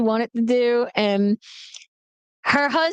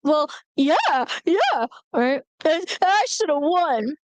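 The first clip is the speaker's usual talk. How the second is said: high, whiny tearful protest; mock crying; 5 semitones higher than usual. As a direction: sulk through pretend tears to Mom. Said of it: "she calls up my mom and she's crying".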